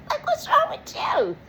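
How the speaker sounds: very high, breathy squeal of disbelief; a whispery high-pitched "what's wrong with you". Acted. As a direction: squeak it out in mock shock. Repeated mock outrage.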